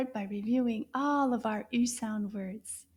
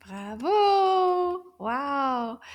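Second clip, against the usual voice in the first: cheer; 4 dB louder, much slower than usual. Real, not acted. Teacher praising the class after the drill: big loud cheer. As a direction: praise them with a big bravo.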